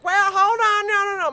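high, loud "hold on"; lady pleading loudly; panicked lady. Retold or imitated. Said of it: "she's like".

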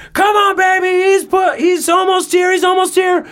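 high excited shouts of encouragement; excited shouting. Retold or imitated. Husband urging his wife through labor, high excited shout. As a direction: cheer her on at the top of your voice. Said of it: "you can hear me just going".

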